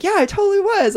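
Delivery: high, loud eager confirmation; excited yes. Retold or imitated. Friend caught wearing the shirt, high excited yes. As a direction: chirp it back brightly high.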